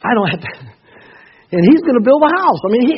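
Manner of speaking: high, loud and thrilled; preacher picturing the disciples' joy, excited; excited preaching. Acted. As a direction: let the excitement burst out.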